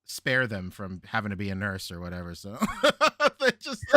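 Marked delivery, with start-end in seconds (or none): 3.0-4.0 laughing through the words; giggling as you speak. Real, not acted. Laughing mid-sentence.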